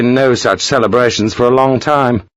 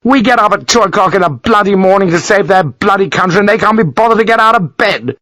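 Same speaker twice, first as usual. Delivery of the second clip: much higher, indignant rant; seething fury; 9 semitones higher than usual. Acted. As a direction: rage at their laziness. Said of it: "seething fury".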